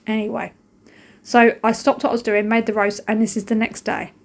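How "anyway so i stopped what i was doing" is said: normal talking voice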